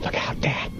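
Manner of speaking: urgent whispered hiss; hissed warning. A narrator's reading. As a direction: hiss a warning without voicing it. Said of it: "Frank hissed".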